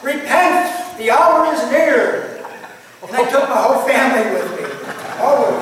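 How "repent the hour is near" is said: loud, slow street-preacher shout through a bullhorn; yelling out. Retold or imitated. Young convert on the street corner shouting to strangers, loud and slow. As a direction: call out repentance to passersby. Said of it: "I was yelling out".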